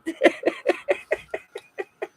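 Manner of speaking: high, airless giggle; breathless giggle. Real, not acted. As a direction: let a squeaky breathy laugh out. Laughing at painted sleeves.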